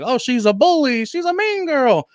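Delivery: ordinary delivery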